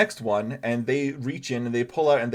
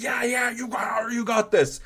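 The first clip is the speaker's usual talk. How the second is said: excited cheering; 11 semitones higher than usual. Acted. Adventurers cheering each other on.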